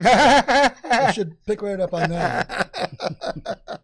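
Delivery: real amused laughter while talking; laughing. Real, not acted. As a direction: chuckle the joke out loud and stretched. Joking about Thor right there.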